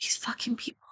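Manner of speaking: soft, breathless whispered curse; exasperated whisper. Real, not acted. Streamer muttering at people doxing themselves, whispered. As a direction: whisper it in disbelief.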